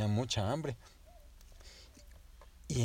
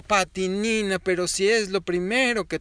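mamá regaña; 9 semitones higher, 7 dB louder than usual. A narrator's reading.

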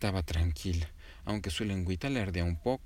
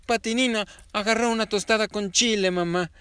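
higher, louder tattling sister; hermana acusa; 11 semitones higher, 7 dB louder than usual. A narrator's reading. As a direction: tell on her sister to mom. (hermanita acusando, más aguda)

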